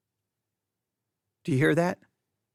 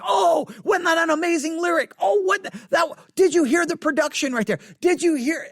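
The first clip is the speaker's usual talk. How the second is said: overexcited shouts; 9 semitones higher than usual. Retold or imitated. Pastor imitates himself shouting at his wife during a movie, much higher. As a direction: gush like a kid at a movie. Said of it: "I'm like".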